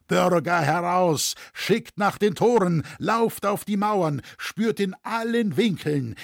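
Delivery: high, urgent calling out; rally the townsfolk with shouted orders; piercing alarm shout. A narrator's reading. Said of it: "eine gellende Stimme schrie durch die Nacht".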